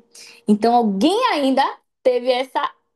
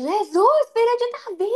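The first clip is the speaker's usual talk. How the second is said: desperate cry; 12 semitones higher, slower than usual. Retold or imitated. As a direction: cry out for Jesus with everything. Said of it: "começou a gritar desesperadamente". (grito desesperado e agudo)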